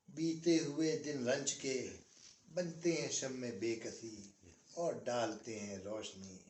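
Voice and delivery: slow, lingering nazm recital, every line weighed; poem recitation. Acted. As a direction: recite the old nazm with a pause after each line. (ठहर-ठहर कर नज़्म पढ़ना)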